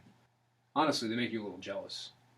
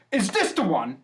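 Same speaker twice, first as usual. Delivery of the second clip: louder and higher, eager; excited dwarf; 7 semitones higher, 7 dB louder than usual. Acted. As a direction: burst in thrilled. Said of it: "bursts back out with a floppy hat and a flower and goes".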